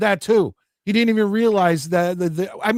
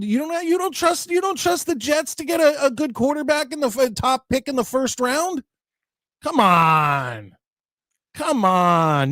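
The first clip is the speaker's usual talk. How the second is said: much higher, pitched up in disbelief; higher disbelieving rant at a viewer's comment; incredulous; 7 semitones higher than usual. Real, not acted. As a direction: scoff at the idea, voice climbing.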